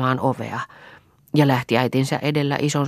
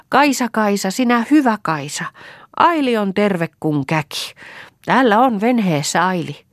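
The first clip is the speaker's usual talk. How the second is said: higher, louder call across the shore; calling back; 7 semitones higher, 3 dB louder than usual. A narrator's reading. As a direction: shout back happily while waving. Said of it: "huiskutti nenäliinallaan ja huusi vastaan". (huutaa vastaan, korkeammalla ja kovempaa)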